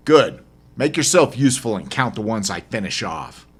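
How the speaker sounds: loud clipped opener then firm command; curt order. Acted. Narrator orders the stowaway to count kills, loud curt command. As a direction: bark the word then give the order.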